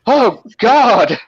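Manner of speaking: mock-panicked moans, pitched high; imitated panic. Patient panics when asked her address, imitated panic.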